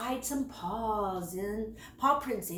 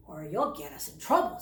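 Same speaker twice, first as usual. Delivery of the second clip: lower, fast brother voice; a slightly lower voiced older cub; Leo's voice; 10 semitones lower, faster than usual. A narrator's reading. Leo brushing off Squeak, lower and fast. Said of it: "said Leo".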